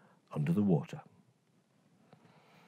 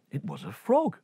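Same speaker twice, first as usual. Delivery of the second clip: usual speaking voice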